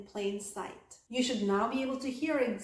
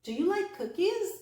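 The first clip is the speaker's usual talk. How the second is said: higher question, lifting on "cookies"; rising intonation; 8 semitones higher, 3 dB louder than usual. Shown as a demonstration. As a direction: ask it with "cookies" going up. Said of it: "Rising intonation".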